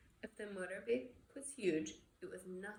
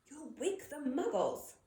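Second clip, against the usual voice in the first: higher, louder urgent scolding; stern McGonagall; 6 semitones higher, 4 dB louder than usual. Acted. McGonagall scolds the weeping giant.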